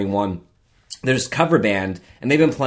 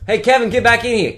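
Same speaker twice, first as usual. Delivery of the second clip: high-pitched call to someone out back; 11 semitones higher, 3 dB louder than usual. Pretend. Calling Kevin in from another room.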